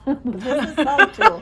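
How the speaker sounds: amused, giggly delivery of a joke name; laughing. Real, not acted. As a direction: laugh your way through the words. Laughs at the tool's nickname.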